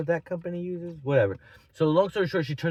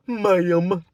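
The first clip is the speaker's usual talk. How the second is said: loud, higher, drawn-out "Ma'am"; pointed ma'am; 4 semitones higher, 8 dB louder than usual. Retold or imitated. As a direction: land "Ma'am" hard as a mocking jab. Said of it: "I hit her with a".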